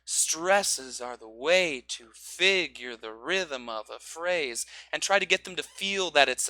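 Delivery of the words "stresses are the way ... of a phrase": slow, with "way" swayed onto the beats; rocking rhythm. Shown as a demonstration. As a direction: rock into each stress. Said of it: "rocking motion".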